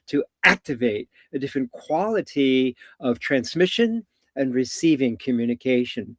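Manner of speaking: ordinary talk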